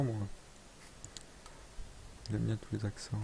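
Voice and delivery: normal talking voice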